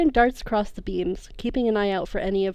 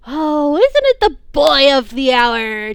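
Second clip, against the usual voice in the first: slow, louder taunting villain; mocking villain; 6 semitones higher, 11 dB louder, slower than usual. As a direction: savour the taunt slowly. Villain Trickster mocks captive.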